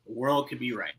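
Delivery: usual speaking voice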